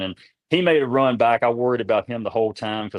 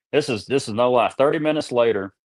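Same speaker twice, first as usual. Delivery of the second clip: usual speaking voice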